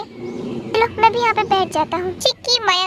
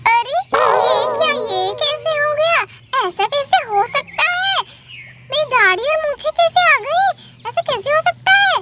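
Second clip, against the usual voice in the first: high-pitched, stunned exclamations; shocked disbelief; 7 semitones higher than usual. Acted. Boy finding a beard on his face, shocked disbelief. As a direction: cry out in high, halting disbelief.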